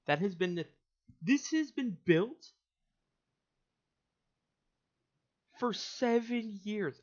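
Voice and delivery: high, loud and slow; indignant outcry; exasperated rant. Real, not acted. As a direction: stretch it out in disbelief. Ranting at map error.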